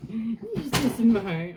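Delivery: high, slow, laughing pet praise; talking to a dog. Real, not acted. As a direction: giggle while cooing at the dog.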